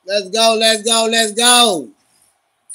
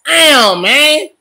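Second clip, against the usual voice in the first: loud, raised-pitch frustration; exasperated outburst; 5 semitones higher, 6 dB louder than usual. Real, not acted. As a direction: blurt it out loud, fed up. Still upset at the play.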